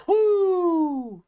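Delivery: long high whoop; excited whoop. Real, not acted. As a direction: let out a whoop. Whoop of relief.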